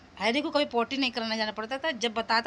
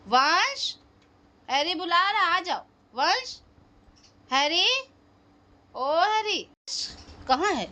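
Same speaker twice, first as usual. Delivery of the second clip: pet talk; 6 semitones higher than usual. Real, not acted. Calling the boy for the dog.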